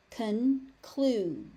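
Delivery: "Conclude" split as con... clude; syllable by syllable. Shown as a demonstration. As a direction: pause between the halves of "Conclude". Teacher breaks word into syllables.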